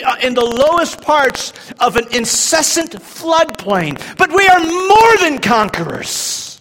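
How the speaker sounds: high, loud shouted sermon; preaching at full force. Acted. Preacher proclaiming Romans eight to the church, shouting. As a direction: thunder Paul's words at the congregation.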